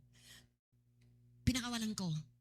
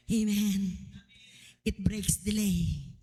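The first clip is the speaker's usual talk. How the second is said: loud, slow preacher's refrain; sermon declaration over music; preaching at full force; 3 dB louder, much slower than usual. Acted. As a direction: drive the slogan home to the congregation.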